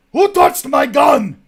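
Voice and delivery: booming put-on voice, rising to a roar; comic gruff shout. Host breaks into a joke character, roaring in mock outrage. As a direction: bellow it like a big gunner missing his weapon. Sudden gag voice, shouted line.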